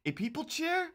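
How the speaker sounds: "A people chair?" squeaked very high in disbelief; incredulous squeak. Real, not acted. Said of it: "why both of you called it a people chair?".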